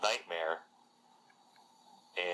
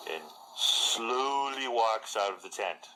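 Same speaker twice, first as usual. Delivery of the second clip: ordinary delivery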